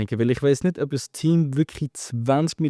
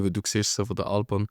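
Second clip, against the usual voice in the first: usual speaking voice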